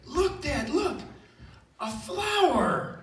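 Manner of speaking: put-on high, wonder-filled child voice; imitating a thrilled small child; awestruck six-year-old. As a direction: show Dad an amazing discovery. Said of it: "his six-year-old son brings him".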